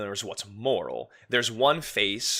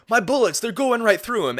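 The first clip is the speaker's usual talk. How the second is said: much higher and louder mock boast; cocky gamer inner voice; 12 semitones higher, 7 dB louder than usual. Streamer mocking a player sure of his aim, high and loud. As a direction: puff up like a deluded gamer. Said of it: "You think".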